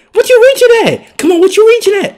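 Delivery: very high, loud yell; high-pitched, loud taunt; heckling shout. Real, not acted. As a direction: holler it at the defender. Heckling defender mid-play.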